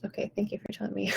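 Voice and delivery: soft, fast whispered thank-you; whispered dismissal. Acted. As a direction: whisper it to brush the voice off.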